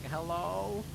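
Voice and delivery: tentative drawn-out hello, rising; lightly voiced old lady on the phone; old lady answering phone.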